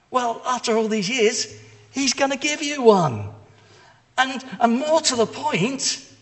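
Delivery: joyful announcement. Acted. Angel announcing joyful news.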